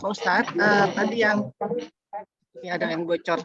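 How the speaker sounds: hoarse from being unwell. Real, not acted. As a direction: speak with a hoarse, raw throat. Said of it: "suara saya agak serak lagi".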